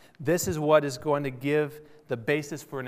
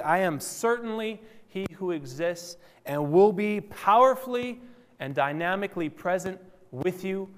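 higher pitch with strong stresses on "certainly" and "powerfully"; emphatic; 6 semitones higher than usual. Retold or imitated. Preacher paraphrasing God's promise to Moses, emphatic. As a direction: declare it, punching "powerfully and dynamically". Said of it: "it comes out more like".

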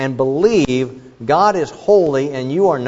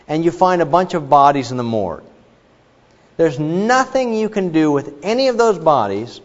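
normal talking voice

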